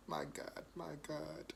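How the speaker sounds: breathless whispered my God; awed whisper. Real, not acted. Reviewer overcome by flavor, awed whisper. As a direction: whisper in disbelief at the taste. Overwhelmed by the sauce.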